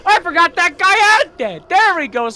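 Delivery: high, loud caster exclamation; excited shout. Real, not acted. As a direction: burst out at the surprise play. Caster erupts at surprise egg.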